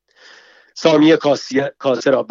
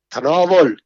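a sharp, loud commanding order; 3 semitones higher, 5 dB louder than usual. Acted. Samiyeh suddenly orders her patient to drink, sharp and commanding. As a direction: bark it as an order.

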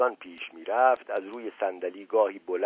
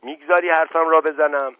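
shouting; 6 semitones higher, 6 dB louder than usual. A narrator's reading. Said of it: "فریاد زد".

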